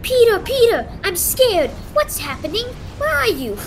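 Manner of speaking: high, frightened calls for Peter; scared calling out. Acted. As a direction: call out for help, scared.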